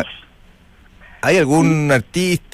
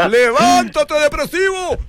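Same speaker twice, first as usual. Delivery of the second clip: mock yell; 12 semitones higher, slower than usual. Mock shouting at caller.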